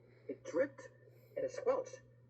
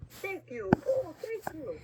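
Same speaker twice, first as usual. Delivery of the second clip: high, gushing thanks; storybook witch crying her thanks; grateful cry; 8 semitones higher, 6 dB louder, slower than usual. A narrator's reading. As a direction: gush with relief as the rescued witch. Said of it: "the grateful witch cried".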